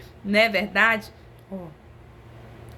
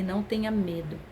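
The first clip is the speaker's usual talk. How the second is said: low, soft relayed message; hushed "don't be afraid"; quiet reassurance; 4 semitones lower, 6 dB quieter than usual. Retold or imitated. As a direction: pass it on quietly, voice dropped. Relayed message from God, first take.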